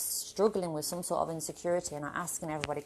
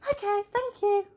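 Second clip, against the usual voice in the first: much higher and louder chirpy thanks; mock-sweet thanks; 7 dB louder than usual. Fired vlogger thanking her boss, much higher and louder. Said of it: "I was like".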